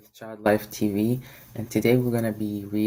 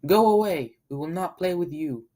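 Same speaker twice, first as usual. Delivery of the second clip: much higher, louder shout; yelling; 7 semitones higher, 4 dB louder than usual. Acted. Ducklings driving away the ugly duckling, yelling. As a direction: chase him off with a yell. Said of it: "They yelled".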